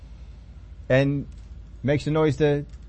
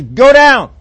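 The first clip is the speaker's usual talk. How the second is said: higher and much louder; booming command; 6 semitones higher, 12 dB louder than usual. Acted. God ordering Moses back down, much louder. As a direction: bark the order down the mountain.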